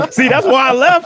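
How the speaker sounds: loud laugh through words; bursting out laughing. Real, not acted. Laughing at demon joke.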